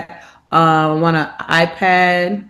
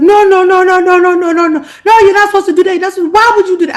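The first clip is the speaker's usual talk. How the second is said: imitating a frantic dad, higher and louder; retold panicked father scolding; hammed-up outraged dad; upset dad; 13 semitones higher, 7 dB louder, faster than usual. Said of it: "his man was hot".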